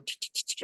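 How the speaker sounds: soft unvoiced tch clicks; imitated pecking. Man imitating wrens at the door, soft pecking. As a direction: make quick tongue sounds like pecking.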